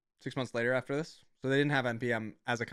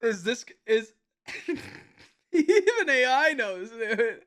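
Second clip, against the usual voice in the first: laughing; 8 semitones higher, slower than usual. Real, not acted. Streamer laughing at AI joke.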